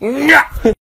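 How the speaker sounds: short high-pitched effort grunt; throwing grunt. Retold or imitated. Nine-year-old throwing at dad, comic grunt. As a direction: mimic a child straining on a hard throw. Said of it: "That was me, the grunt and everything".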